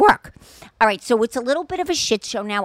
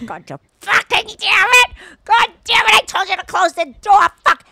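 high, loud exasperated swearing; muttered cursing; 10 semitones higher, 7 dB louder than usual. Retold or imitated. Husband cleaning puppy pee, swearing in frustration. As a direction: curse at the puppy mess. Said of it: "under his voice".

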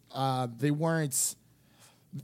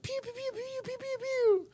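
very high, squeaky pew pew gun sounds; imitated blaster noises; 4 dB quieter than usual. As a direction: play at firing a laser gun with your mouth. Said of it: "where she goes".

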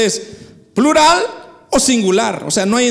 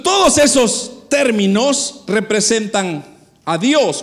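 usual speaking voice

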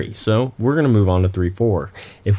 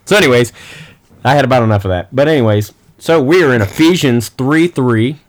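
energetic; 4 semitones higher, 6 dB louder than usual. Real, not acted. I had about enough of that.

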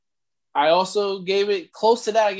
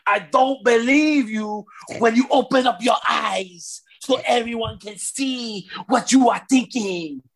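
drawn-out, exaggerated Nigerian accent; hammed-up Nigerian promo voice; mock Nigerian accent. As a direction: do an over-the-top wrestler's Nigerian accent, stretching the name. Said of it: "They added the accent".

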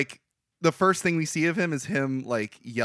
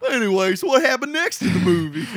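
loud, high put-on yokel; hammed-up dim country bumpkin; 8 semitones higher, 7 dB louder than usual. Starts hick voice after sounding dumb.

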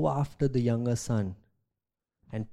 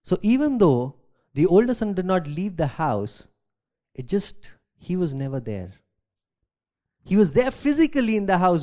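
usual speaking voice

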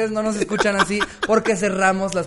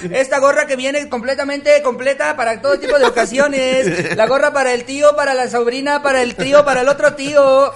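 high, loud vendor singsong; metro vendor pitch; 7 semitones higher, 7 dB louder than usual. Retold or imitated. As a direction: sell caps like a metro peddler. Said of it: "tú eres la voz del". (pregón de vendedor del metro, agudo y fuerte)

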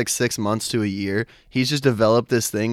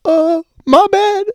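much higher and slow apology; mock sheepish little brother; 6 dB louder, much slower than usual. Brother caught swearing, apologising in a high slow squeak. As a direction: squeak it out, caught red-handed. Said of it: "and he's like".

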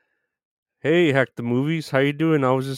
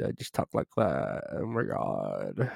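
put-on gossiping-girl voice; 9 dB quieter than usual. Shown as a demonstration. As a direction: show off the gossipy voice, getting softer. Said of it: "That's how I do this voice".

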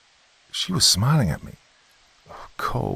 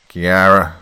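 snap; 10 dB louder than usual. Acted. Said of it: "he snapped".

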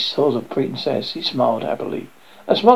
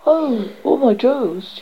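offering princess; 10 semitones higher than usual. A narrator's reading. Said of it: "she offered".

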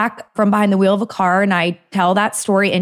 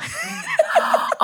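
big, very high burst of real laughter; 5 dB quieter than usual. Real, not acted. Mortified in the ice bath.

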